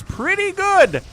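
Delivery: high, slow, stretched-out pretty good; drawn-out praise. Real, not acted. Caster praising the Lurker play, drawn out high and slow.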